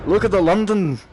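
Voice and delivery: mock London accent, strongest at the start; thick London accent. Streamer ribbing a little whippersnapper, mock London accent. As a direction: put on a rough go at a London accent. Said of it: "talk with a real thick accent".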